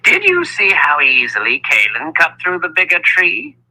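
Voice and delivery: put-on high, innocent old wizard. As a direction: ask it with mock innocence, pitched high. Wizard Zed speaks.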